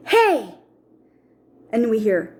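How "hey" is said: high, raised calling "hey"; testing call. Retold or imitated. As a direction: call out to test for an echo. Daughter calls out to test echo.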